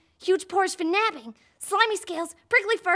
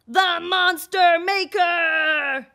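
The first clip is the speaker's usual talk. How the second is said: grand announcement; 6 dB louder, much slower than usual. Acted.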